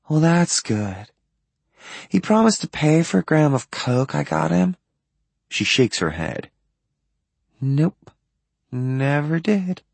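put-on lightly slurred, tired and drunk; drunk slurring. A narrator's reading. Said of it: "her voice thick".

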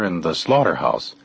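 usual speaking voice

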